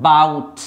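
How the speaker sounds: বাউট with the opening a dropped, starting straight on b; teacher says বাউট with no vowel before the b; silent first a. Shown as a demonstration. Teacher to students, বাউট said with the first a silent. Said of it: "actually এটা silent থাকে".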